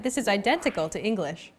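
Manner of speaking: normal talking voice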